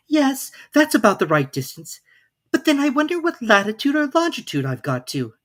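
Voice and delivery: put-on young Alice working it out aloud, voice going up. A narrator's reading. Alice's musings.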